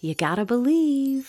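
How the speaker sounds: echoing magic mirror; shimmering echoing mirror voice. Acted. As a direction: voice of an enchanted mirror. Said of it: "the mirror said".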